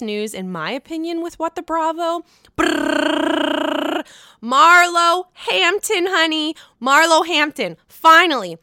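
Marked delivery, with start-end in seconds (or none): 2.5-4.0 drum roll. Acted. Drum roll for big news. 4.4-8.6 excited announcement. Real, not acted. Announcing the biggest news.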